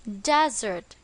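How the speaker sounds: "desert" with the stress on its first syllable, DEZ-ert. Shown as a demonstration. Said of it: "On the first syllable".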